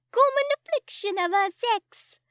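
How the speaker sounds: high, loud Cockney; smug Cockney constable; hammed-up high Cockney; Cockney accent. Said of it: "Cockney accent".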